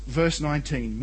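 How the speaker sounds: ordinary talk, nothing marked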